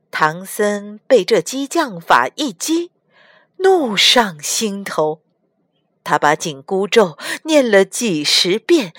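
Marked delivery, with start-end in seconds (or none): none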